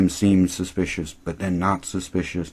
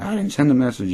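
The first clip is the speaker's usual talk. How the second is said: higher-pitched petulant denial; mock denial; 7 semitones higher than usual. Host acting Zoe denying it to Audrey, mock protest. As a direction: imitate a squabbling comeback, pitched up. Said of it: "And she's like".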